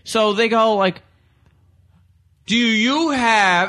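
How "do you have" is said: exaggerated slow, higher-pitched clerk imitation; slow foreigner talk. Clerk to a man assumed not to speak English, slow foreigner talk. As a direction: stretch each word out, mimicking a patronizing clerk.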